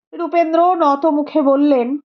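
ordinary delivery, nothing marked

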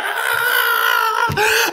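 high-pitched reaction scream; exaggerated scream. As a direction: let out a long, hammed-up scream. Mimics his wild reaction to bar.

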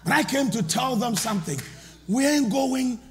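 slow, every word hammered; defiant preaching. Acted. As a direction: plant your feet and declare it. Defiant climax of sermon.

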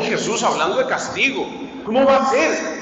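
ordinary talk

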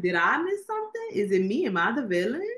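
high, squeaky-pitched mock confusion; mock bewilderment. Viewer reacting to the crying daughter, high mock bewilderment. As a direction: play the baffled viewer asking if it's her fault. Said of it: "I was like".

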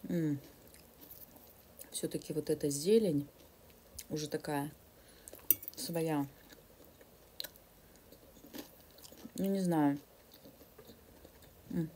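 chewing salad while talking; words muffled by food; mouth full of food. Real, not acted. Seen 0: Fork brings greens to mouth at 3.0 and 5.6; chewing between.

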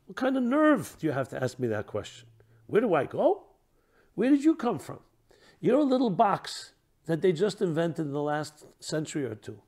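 indignant comeback, pitch jumping on the questions; incredulous indignation. Acted. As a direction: act out affronted disbelief. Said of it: "responds with an incredulous indignation and tone".

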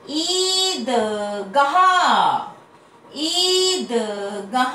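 ईंट, ईख, ईद stretched slowly on the long ई; long ii drawn out. Shown as a demonstration. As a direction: drill each word slowly, holding the long ई. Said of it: "बड़ी ई की ध्वनि थोड़ा बड़ा". (लंबी ई खींचकर, धीरे-धीरे)

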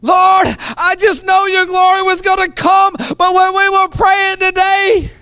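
shouting a frustrated prayer up high; mock-pleading prayer at full voice; mock desperate prayer. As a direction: cry out to God, hammed-up complaint.

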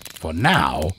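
ordinary delivery

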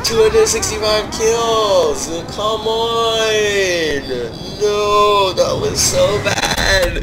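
loud, high anguished gamer outburst, slowed; anguished shout. Real, not acted. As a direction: howl at the screen in disbelief. Dies at high kill count.

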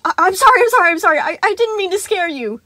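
high, loud tumbling sorries; rushed apology. Acted. Animatronic rushing apologies to the crying boy, high and loud. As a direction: gabble apologies in a panic. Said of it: "saying in a rush".

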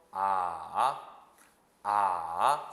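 "아" dipping low, then rising; third tone. Shown as a demonstration. Teacher modelling the third tone, low dip then rise. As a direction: scoop "아" down and swing it back up. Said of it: "삼 성".

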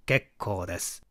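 plain reading voice

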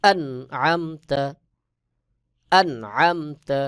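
আনআমতা with the nun sakin said clearly, slow and drawn out; clear izhar recitation. Shown as a demonstration. Teacher models আনআমতা for students, slow and distinct. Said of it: "নুন সাকিনকে স্পষ্ট করে পড়তে হবে".

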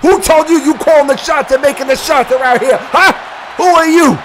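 high, heated challenge; confrontational. Acted. Guest referee challenging who calls the shots, heated. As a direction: demand answers, building to a shout.

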